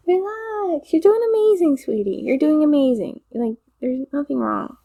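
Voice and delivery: high-pitched sweetie pep talk; cooing reassurance. Acted. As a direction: coo it high, like soothing someone overworked. Voices soothing pep talk to the listener.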